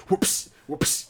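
whispered imitation of belt smacks; breathy whipping sound effects; belt whoops. A dad mimics the belt lashes he heard upstairs, whispered. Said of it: "I just come out of nowhere like".

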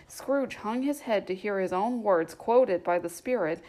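ordinary delivery, nothing marked

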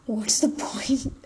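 breathy rhetorical whisper, slowly; whispered aside. Real, not acted. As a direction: let it slip out as a slow whisper. Whispered aside after a laugh.

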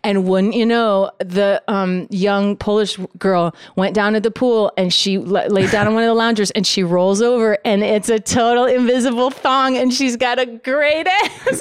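ordinary talk

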